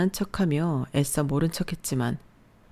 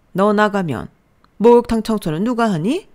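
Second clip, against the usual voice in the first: raised, challenging mother-in-law voice; scornful mother-in-law; 6 semitones higher, 7 dB louder than usual. A narrator's reading. Mother-in-law answers wife's threat to leave, scornful. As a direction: throw it back at her scornfully.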